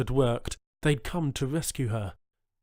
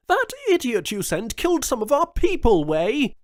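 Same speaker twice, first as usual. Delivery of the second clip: much higher and louder, raging; furious governor; 12 semitones higher, 8 dB louder than usual. A narrator's reading. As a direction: let the fury burst out. Jones furious at cousin.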